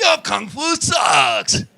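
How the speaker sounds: slow, hammed-up martial-arts taunt; mock kung fu challenger. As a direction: draw out each word like a kung fu film villain. Mimics a kung fu film challenger.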